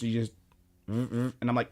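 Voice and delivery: usual speaking voice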